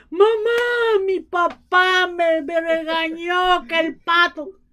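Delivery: put-on high-pitched whiny boy tattling; little boy complaining to mom; whining child. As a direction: wail it like a scolded kid. Brother complains to mother.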